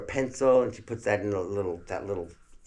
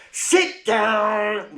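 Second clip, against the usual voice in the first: mother barks it high and loud; stern shout; 12 semitones higher, 10 dB louder than usual. Retold or imitated. Mother ordering the rowdy kids, loud stern shout. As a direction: snap the kids into their seats. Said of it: "when she said like that".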